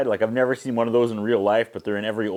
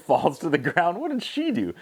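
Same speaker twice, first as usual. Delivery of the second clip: ordinary talk, nothing marked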